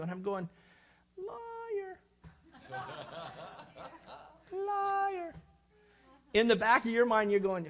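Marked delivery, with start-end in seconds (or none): 1.2-5.3 high, breathy squeak of Liar; mock accusation. Pastor answering the friend's praise, high mock squeak. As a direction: squeak the word out in disbelief.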